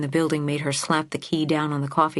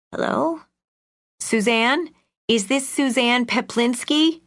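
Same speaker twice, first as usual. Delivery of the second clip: higher, raised call down the line; shouting into the phone; 8 semitones higher, 3 dB louder than usual. Acted. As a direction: call out to a deaf listener. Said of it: "Iris shouted into the phone".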